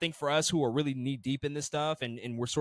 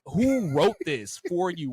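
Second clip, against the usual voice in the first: incredulous; 5 semitones higher, 6 dB louder, slower than usual. Real, not acted. Incredulous reaction to bad accent.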